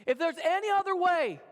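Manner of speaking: acted plea, pitched high; high, imploring call to a father. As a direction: lift the voice in an imploring prayer. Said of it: "heard him call out to his Father".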